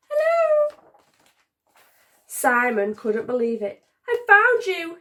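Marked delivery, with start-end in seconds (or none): 0.1-0.8 very high, loud cheerful call; squeaky hello. Acted. Hidden sock calling up to Simon, high and bright. Mystery voice under drawers. 4.1-5.0 much higher, louder delighted cry; joyful cry. Acted. Simon finding his pair under the drawers, overjoyed. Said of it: "he cried".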